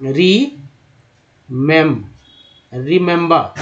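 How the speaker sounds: री, मेम, then the whole word रिमेंबर; teacher builds रिमेंबर up from its syllables. Shown as a demonstration. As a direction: split रिमेंबर into री and मेम before saying it whole. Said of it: "उच्चारण क्या करते हैं?".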